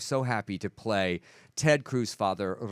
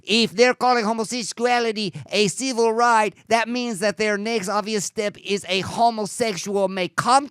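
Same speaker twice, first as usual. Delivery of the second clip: higher and louder, a thick Spanish-tinged preacher's voice; 9 semitones higher, 4 dB louder than usual. Retold or imitated. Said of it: "His father's accent is that thick?".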